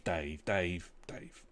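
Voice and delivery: usual speaking voice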